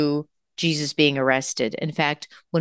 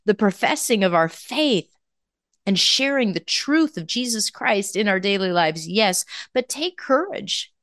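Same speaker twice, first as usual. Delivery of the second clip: fervent preaching; 5 semitones higher than usual. Acted. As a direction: preach it with fire to the congregation. Preaching with rising fervor.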